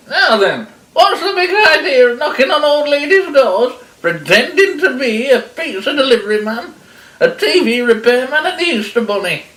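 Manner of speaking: put-on high, loud indignant old lady; scolding granny. Granny interrogating the wolf tied to her sofa, scolding loudly. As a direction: give him a granny's telling-off.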